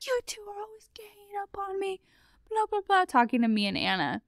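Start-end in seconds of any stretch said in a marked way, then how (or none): 0.1-3.0 high, breathy mocking whine of the roommate. Mimicking the angry roommate's complaint in a high whisper. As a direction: sneer it out like a sulky roommate. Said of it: "And she loses it".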